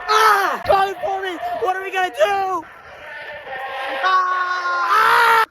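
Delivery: high shrieking yells of panic; panicked screaming. Pretend. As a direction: scream like something's chasing you. Fleeing a pretend monster.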